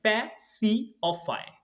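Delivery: slow, separated syllables. Shown as a demonstration. Teacher splitting a spelling for students, syllable by syllable. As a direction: break it into three slow pieces. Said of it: "तीन छोटे-छोटे syllable".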